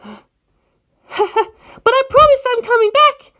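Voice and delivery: drawn out slowly, high and earnest; slow promise. Acted. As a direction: stretch the promise out.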